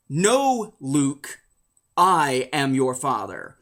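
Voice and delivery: slow, low, weighty villain reveal; Vader. Retold or imitated. Villain telling Luke he is his father, solemn imitation. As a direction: drop low on a drawn-out "I am your father". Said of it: "To which Vader says".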